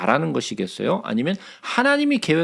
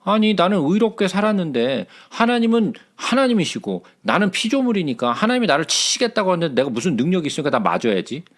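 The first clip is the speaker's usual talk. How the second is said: usual speaking voice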